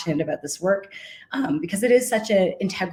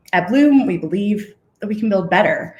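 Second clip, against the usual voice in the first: getting emotional; 2 semitones higher, 5 dB louder than usual. Real, not acted. Founder moved while talking about her team, emotional. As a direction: voice swelling with real feeling. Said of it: "Sorry, I'm getting emotional".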